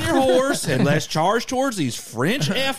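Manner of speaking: loud, high country battle cry; rally the troops in a hammed-up country accent. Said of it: "a country accent than what you think".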